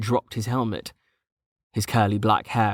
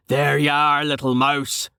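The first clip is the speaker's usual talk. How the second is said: big, loud, hearty call with raised pitch; booming; 4 semitones higher, 4 dB louder than usual. A narrator's reading. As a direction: boom it out as the burly character greeting his find. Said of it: "Carten boomed".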